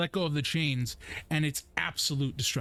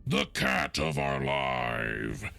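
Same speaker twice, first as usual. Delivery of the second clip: orc announcer; 9 semitones lower, slower than usual. Acted. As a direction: lower and very slow, drawn out. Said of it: "he raises his hands".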